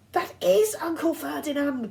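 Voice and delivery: high, loud startled gasp; gasped alarm. A narrator's reading. Boy realizing the statue is his uncle, high and loud. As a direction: blurt it in horror. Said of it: "Grover gasped".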